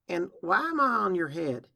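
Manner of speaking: high, loud, puzzled filly; baffled question. Acted. Dazed filly perched on Applejack's head, baffled question.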